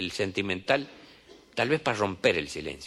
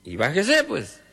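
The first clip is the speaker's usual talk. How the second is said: lightly voiced healer; 8 semitones higher, 4 dB louder than usual. Healer invites him.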